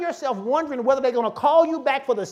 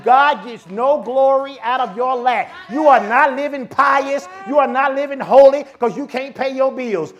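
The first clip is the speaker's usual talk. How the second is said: preaching at full force; 3 semitones higher, 8 dB louder than usual. Real, not acted. Pastor shouting faith to the congregation, full-force preaching. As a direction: whip the church up into praise.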